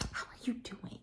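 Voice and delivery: whispered, timid little question; mock whisper. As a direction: whisper it as if sneaking it in awkwardly. Mimics awkward timid question at table.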